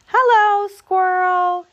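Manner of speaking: high, loud singsong greeting; sustained high hailing voice; calling out. A narrator's reading. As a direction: call down a hole to a friend. Groundhog calling into Squirrel's hole.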